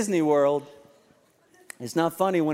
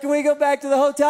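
put-on higher, quick kids' begging; imitating pleading kids; 8 semitones higher, 5 dB louder, faster than usual. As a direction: copy the children nagging to leave. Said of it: "They're like".

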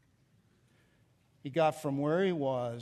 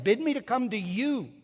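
"come to you" pitched higher, with emphasis; emphasis on coming; 5 semitones higher than usual. Acted. Preacher quoting Peter's wish to reach Jesus, "come to you" emphatic. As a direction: lean into "come to you" as the whole point. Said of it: "The emphasis is on coming to Jesus".

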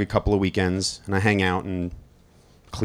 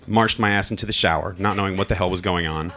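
ordinary talk, nothing marked

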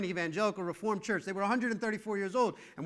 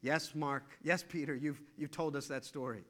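weary reply; 4 semitones lower, 7 dB quieter than usual. Pretend. Mark answering weary Peter again.

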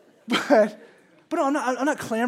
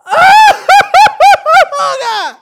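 long, very high, loud wail; hammed-up piercing tantrum howl; toddler tantrum scream; 21 dB louder than usual. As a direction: scream as if the world is ending. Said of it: "No, it's".

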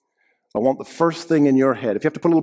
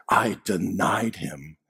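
guilty murmur; 6 semitones lower, 6 dB quieter, slower than usual. Acted. Peter's guilt each morning.

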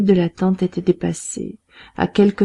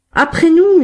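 much higher and louder, a group roar; gang shout; 10 semitones higher, 8 dB louder than usual. A narrator's reading. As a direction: bellow it like the whole gang at once. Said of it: "hurlèrent les bandits".

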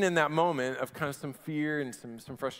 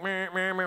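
mock buzzing alarm tone; imitated phone alarm; 4 semitones higher than usual. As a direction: buzz it like a phone alert. Phone alert sound imitated.

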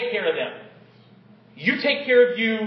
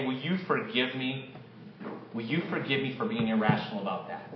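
much softer, lower, humble asking; humble apology; 10 semitones lower, 10 dB quieter than usual. Pretend. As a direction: ask it gently and humbly. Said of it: "go up to them and say".

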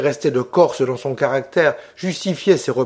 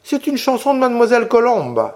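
petite fille; 8 semitones higher than usual. A narrator's reading. Fillette répond à Orso.

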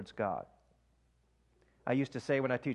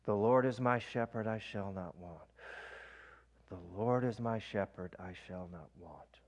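breath prayer demo; 4 semitones lower, 5 dB quieter than usual. Shown as a demonstration. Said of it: "Let me give you an example".

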